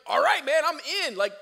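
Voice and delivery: high, loud, eager; fired up and ready; pumped up. Retold or imitated. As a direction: Moses eager to go. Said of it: "You'd think he's like".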